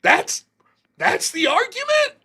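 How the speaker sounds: incredulous, rising to a high-pitched question; mock disbelief. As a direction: voice leaping high in imagined bafflement. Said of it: "They'd be like".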